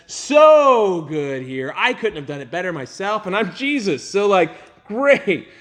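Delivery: high, loud, hammed-up rave; mock ecstatic cheerleader; gushing praise. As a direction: heap on the compliments, way over the top. Imagined Jesus gushing over us.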